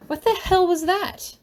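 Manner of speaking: high, startled what-the-hell; shocked outburst. Acted. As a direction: blurt it out in disbelief. Tommy shocked after being pushed.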